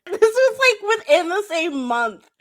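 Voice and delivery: high laughing delivery. Real, not acted. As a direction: laugh through the line. Laughing at own story.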